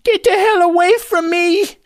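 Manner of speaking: put-on high, slow, frightened shriek of an old lady; terrified old woman. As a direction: recoil in panic, voice climbing very high. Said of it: "She's terrified".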